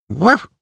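high sharp yap of a tiny dog; puppy bark, high and loud; little dog yap. Acted. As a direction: yap like a small terrier. Dog barks on appearing.

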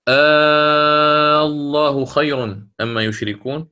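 slow chanted recitation with a long stretched madd; Quran recitation. Shown as a demonstration. Teacher recites the Yunus example aloud, slow tajweed chant. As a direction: chant the verse, holding the vowels long. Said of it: "في سورة يونس".

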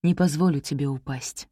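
low, rough hoarse voice. A narrator's reading. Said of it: "ответил я хрипло".

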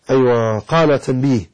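usual speaking voice

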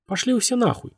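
high-pitched, word-by-word curse; articulated distinctly. A narrator's reading. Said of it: "членораздельно сказал Костя". (членораздельно, высоким голосом)

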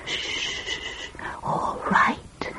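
very soft, whispered shush; hushing whisper. Acted. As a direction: hush the child, then give in. Hushing the squealing little sister.

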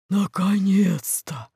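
booming underwater voice. Acted. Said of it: "гулкий голос из-под воды".

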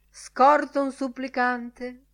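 high, slow, melodious announcement. A narrator's reading. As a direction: intone it musically and slowly. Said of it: "disse melodiosamente mister O'Madden Burke".

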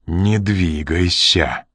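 slow, measured threat; menacing. A narrator's reading. Ян aiming a Mauser at Ренли, menacing. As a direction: order it slowly, with menace. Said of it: "Что-то в голосе Яна заставило".

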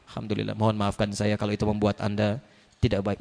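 low, soft humble apology; meek reassuring sorry. Pretend. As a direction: apologise gently to calm them. Soft apology to angry person.

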